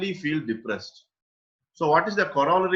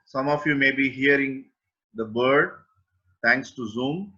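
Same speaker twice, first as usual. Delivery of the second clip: guided meditation. Acted. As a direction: guide listeners gently into awareness, with long pauses. (unhurried guided-meditation delivery, phrase by phrase)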